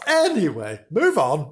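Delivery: ordinary delivery, nothing marked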